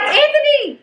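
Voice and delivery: high mock holler of a name; retold calling out. Mom calling her oldest son at the water, retold call. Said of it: "And I'm like".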